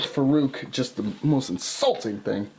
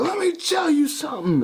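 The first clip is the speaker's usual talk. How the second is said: much higher, hammed-up promo line; mock wrestler promo; 12 semitones higher than usual. Imitating the Blackjacks on the mic for a co-host, hammed up and high. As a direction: ham up a wrestler grabbing the mic. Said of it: "they're like".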